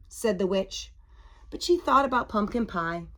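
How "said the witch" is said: usual speaking voice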